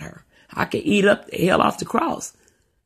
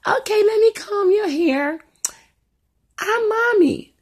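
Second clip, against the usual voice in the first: high, slow little girl playing mommy to her dolls; 3 dB louder, slower than usual. Mother voicing her little daughter at play, high girlish voice. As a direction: pitch way up like a small child.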